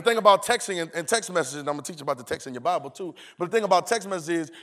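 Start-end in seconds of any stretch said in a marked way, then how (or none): none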